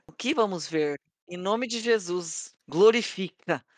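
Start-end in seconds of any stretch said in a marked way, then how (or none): 1.3-3.6 mock prayer. Mock prayer while spinning.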